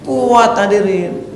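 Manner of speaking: preaching at full force; fiery preaching. Acted. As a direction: rouse the congregation.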